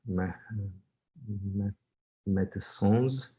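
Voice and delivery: put-on surname drawn out slowly in a light French accent. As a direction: play it like a French announcer saying the surname. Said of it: "second name in a French accent".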